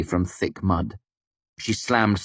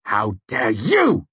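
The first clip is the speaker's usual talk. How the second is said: slow, louder angry retort; spat angrily; 4 semitones higher, 4 dB louder, slower than usual. A narrator's reading. Narrator spitting back at Devi, angry. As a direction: spit the words back at her. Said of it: "I spat back angrily".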